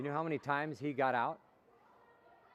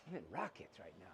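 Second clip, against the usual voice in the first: low, soft, determined mutter; pumping himself up under his breath; low self-talk; 8 semitones lower, 14 dB quieter, faster than usual. Shown as a demonstration. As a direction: quietly psych yourself up. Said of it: "You gotta pump yourself up".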